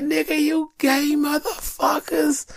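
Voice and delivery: high, slow mocking jeer; high jeering heckler voice; mocking onlookers. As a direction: sneer it out like a heckler, high and drawn out. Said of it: "First thing they be like".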